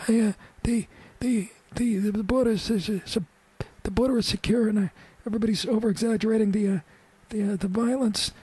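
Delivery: a flustered frail senator dodging a burrito; Harry Reid impression. Host acting Harry Reid hit by a burrito, high stammer. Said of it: "he gets hit in the face".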